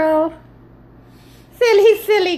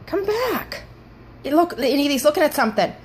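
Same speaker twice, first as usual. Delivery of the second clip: ordinary delivery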